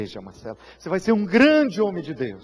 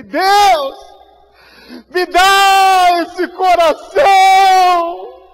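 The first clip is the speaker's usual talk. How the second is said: drawn-out, much higher and louder plea; crying out to God; 12 semitones higher, 13 dB louder, much slower than usual. Acted. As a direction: stretch every word out as a desperate prayer. Narrator's inner prayer, crying out.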